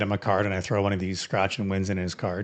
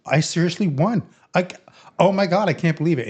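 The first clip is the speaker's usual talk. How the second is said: high, louder, overjoyed disbelief; imitating excited; 9 semitones higher, 4 dB louder than usual. New cop tells friend he won the lottery, excited. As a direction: gush like you just hit the jackpot. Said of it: "And he's so excited".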